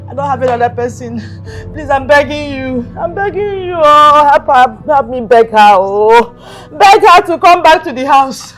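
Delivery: loud, drawn-out wailing; crying plea. Acted. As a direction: beg through dramatic wails. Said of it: "how can a mother be crying like this?".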